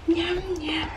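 soft unvoiced whisper to a baby. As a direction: breathe it in a playful whisper. Feeding baby, then whispered.

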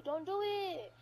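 ordinary delivery

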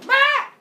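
short, very high loud shriek; sudden piercing baby cry; baby's wail. Acted. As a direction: imitate a baby popping out screaming. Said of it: "the baby goes".

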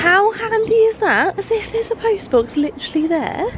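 high, excited marvelling; delighted surprise. Real, not acted. Vlogger spots a post box by the new house, delighted. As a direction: marvel at it, pitched well up.

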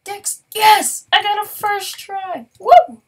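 high, loud triumphant whoops; shouting yes with real glee; excited cheer. Real, not acted. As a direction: celebrate a lucky win out loud. Succeeds on first try.

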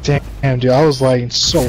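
loud frustrated gamer yell. Real, not acted. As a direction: shout the complaint. Friend yells about lag.

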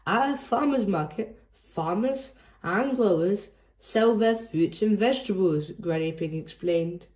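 ordinary delivery

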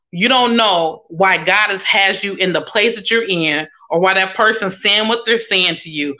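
usual speaking voice

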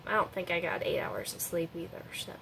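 usual speaking voice

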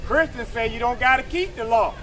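ordinary talk, nothing marked

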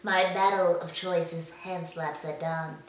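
pinch your nose and speak; pinched-nose duel challenge; nasal voice. Acted.

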